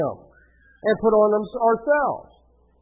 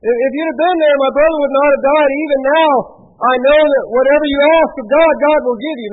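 louder, higher pulpit reading; scripture reading; 6 semitones higher, 8 dB louder than usual. A narrator's reading. Preacher reads Martha's plea to Jesus, projected scripture reading. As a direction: read it out with conviction.

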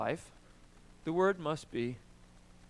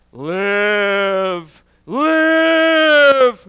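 booming, rising incantation, much louder; a mock wizard's command shouted higher the second time; commanding magic word; 3 semitones higher, 18 dB louder than usual. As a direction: cast it like a spell calling something to life. Magic word spoken to bring life.

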